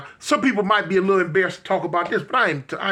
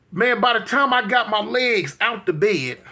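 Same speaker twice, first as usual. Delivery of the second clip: ordinary delivery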